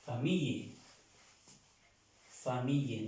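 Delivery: "Famille" with the double L as a y; teacher's careful "fa-MEE-ye", repeated; French pronunciation. Shown as a demonstration. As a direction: model "Famille" as fa-MEE-ye, falling. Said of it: "se pronuncia como en el español".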